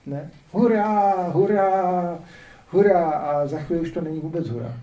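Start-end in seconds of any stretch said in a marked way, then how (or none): 0.5-3.1 mock crowd cheer, louder. Speaker imitating cheering crowds in history, loud mock cheer. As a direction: chant hurá like a jubilant crowd. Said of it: "těch jásajících davů".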